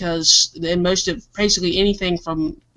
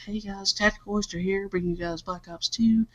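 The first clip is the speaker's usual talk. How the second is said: hushed late-night commentary voice; quiet at night; 8 dB quieter than usual. Shown as a demonstration. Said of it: "and I'm talking like this".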